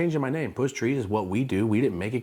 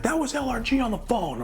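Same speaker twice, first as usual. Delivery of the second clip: high-pitched retold yell; mock yelling; 13 semitones higher than usual. Friend comes back in upset about the call, mock high yell. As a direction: imitate his outraged high-pitched yelling. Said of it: "he comes in yelling at me".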